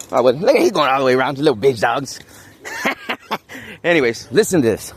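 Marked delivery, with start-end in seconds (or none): 2.6-3.8 high laugh. Real, not acted. Laugh at dogs.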